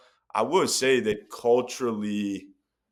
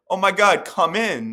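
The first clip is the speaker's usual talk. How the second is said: excited welcome; 9 semitones higher, 7 dB louder than usual. Retold or imitated. Foreigners welcoming a Canadian.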